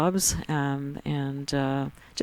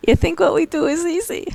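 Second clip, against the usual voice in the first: high, loud laughing mock outrage; 5 dB louder than usual. Real, not acted. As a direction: laugh while pretending offense.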